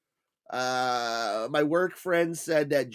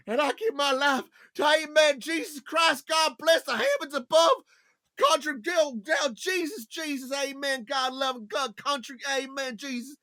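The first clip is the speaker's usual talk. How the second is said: mock preachy singer; 11 semitones higher than usual. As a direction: ramble holy words like a teary star. Imitating [name]'s religious speech.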